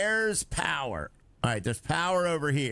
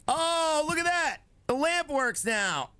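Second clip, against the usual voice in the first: excited; 11 semitones higher than usual. Real, not acted. Lamp finally works.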